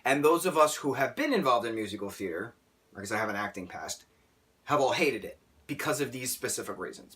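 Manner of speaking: ordinary talk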